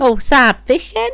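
put-on Australian accent. Boyfriend redoing the phrase when prompted, Australian accent. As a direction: switch into mock Australian. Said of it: "Uh, Australian".

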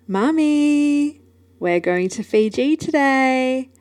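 put-on high, drawn-out child's singsong; imitated daughter. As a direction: imitate an excited little girl calling Mum. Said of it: "to my daughter going".

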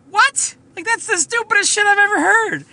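high, loud disbelieving cry; shocked retort to a surgeon, high and loud; incredulous outburst. Retold or imitated. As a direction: shriek it like you can't believe your ears. Retelling her retort to surgeon.

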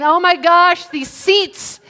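high, loud fussy complaint; mock griping. Churchgoer griping about the seats, mock exasperation. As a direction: play up a petty grievance.

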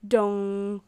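long, loud monotone dong; clock chime. A narrator's reading. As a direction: ring out like a striking clock. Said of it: "run the clock".

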